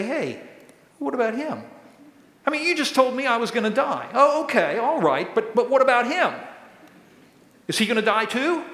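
high-pitched, indignant whataboutism; mock protest. Peter points at the guy next to him, protesting in mock indignation.

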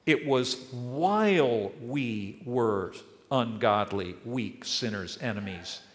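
slow, weighty words; slow and solemn. Acted. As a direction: let each word land slowly. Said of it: "slow emphatic list".

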